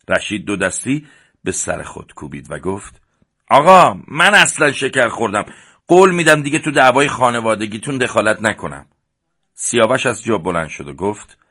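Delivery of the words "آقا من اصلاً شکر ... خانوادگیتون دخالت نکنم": louder, higher mock-surrendering plea; exasperated protest. Rashid backs off from the brothers' feud, exasperated. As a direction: throw up your hands and give up, hammed-up. Exasperated after Babak's glare.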